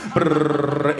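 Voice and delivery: long rolled "brrr" trill; imitated phone ring. He waits as Nikita's phone rings, rolled trill.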